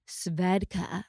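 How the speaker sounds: low, soft sultry Svedka; sultry narrator. Shown as a demonstration. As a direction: purr Svedka like an ad voice. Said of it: "Do it in your sexy vo".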